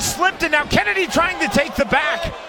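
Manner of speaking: urgent high-pitched play-by-play; excited fight call. Real, not acted. Live call of a slip and scramble.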